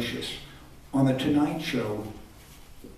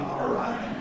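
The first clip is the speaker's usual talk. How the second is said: whispered all right; 10 semitones higher, 6 dB quieter than usual. Retold or imitated. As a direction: drop to a breathless whisper. Wry reply in retold chat.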